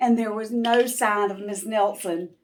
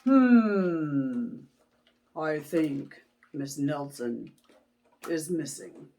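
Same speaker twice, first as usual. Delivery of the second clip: detective voice; 4 semitones lower than usual. Acted. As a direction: mull it over slowly. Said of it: "he said".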